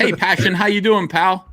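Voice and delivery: high, bright friendly hello; cheery greeting voice. Shown as a demonstration. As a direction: greet your pal with big cheer. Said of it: "do you think this is how I talk to my wife?".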